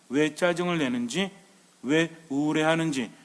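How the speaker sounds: ordinary talk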